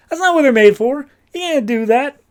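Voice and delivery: high, loud mock objection; alarmed protest. Will protests the assassination plan.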